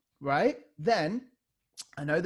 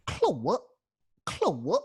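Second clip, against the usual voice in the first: Claire, Claire scratched with rising pitch; vocal scratch on a name; 3 semitones higher, 4 dB louder than usual. Shown as a demonstration. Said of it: "So go".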